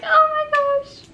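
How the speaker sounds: very high, loud overwhelmed cry; amazed. Retold or imitated. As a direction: gasp in wonder at the baby. Baby comes out.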